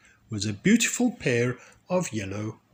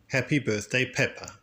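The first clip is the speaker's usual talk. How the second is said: ordinary delivery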